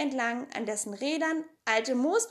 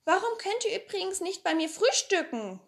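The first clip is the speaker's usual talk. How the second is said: inviting Pippi; 9 semitones higher than usual. Acted.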